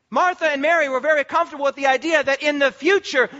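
ordinary talk

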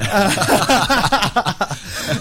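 high, rolling burst of laughter; hearty laugh. Real, not acted. Host laughing after agreeing "me too", hearty laugh.

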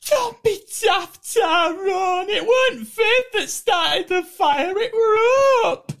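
hammed-up tearful soap-star plea, near shouting; Fizz from Coronation Street. Fizz pleads with Tyrone about the fire, a high crying wail. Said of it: "Jenny McAlpine as Fizz".

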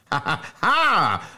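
slow, high acted laugh; roaring laugh. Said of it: "roared Bob Cherry".

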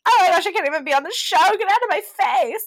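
very fast, loud, high-pitched outburst; mock freak-out. Co-host imitates contestant storming off from Peter, mock freak-out. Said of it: "her freaking out".

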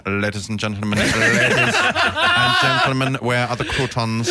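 slow, drawn-out villain voice with a laugh; Alan Rickman impression. Fanboys near Rickman, mock villain announcement. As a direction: mimic the villain to a buffet. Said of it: "just going".

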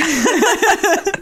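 high-pitched burst of laughter; laughing. Real, not acted. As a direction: crack up at your own joke. Laughing at own silly joke.

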